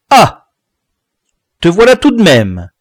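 loud, high-pitched sardonic greeting; half-dry, half-mocking. A narrator's reading. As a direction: greet him curtly with a mocking edge. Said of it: "d'un petit air mi-sec, mi-narquois".

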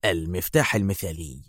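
low, soft, savoured words; quietly triumphant. A narrator's reading. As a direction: drop the voice with satisfaction. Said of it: "ضحكة ظافرة مع استطرادته".

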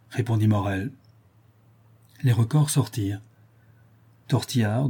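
usual speaking voice

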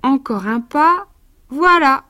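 put-on lively verse read to a child; lecture de conte. A narrator's reading. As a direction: read it like a bedtime picture book. Reads the verse tale. (lecture animée de conte pour enfants)

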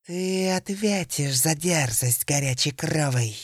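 hissed, menacing serpent voice; angry snake hiss. Acted. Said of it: "была чертовски зла и прошипела".

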